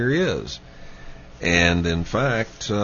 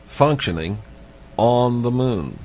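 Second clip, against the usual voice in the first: ordinary talk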